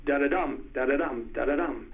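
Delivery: quiet da-da-DUM beat, landing on the last; rhythm demo. Shown as a demonstration. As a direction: drum out an anapest softly. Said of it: "you know".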